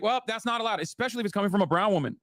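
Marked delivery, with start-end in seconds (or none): 0.0-0.8 mock prim objection. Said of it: "they would be like".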